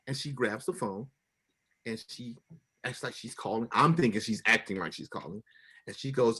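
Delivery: usual speaking voice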